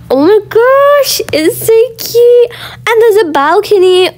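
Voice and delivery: gasp, then high slowed gushing; high delighted oh my gosh. Real, not acted. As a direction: coo over how cute it is. Arriving on second floor.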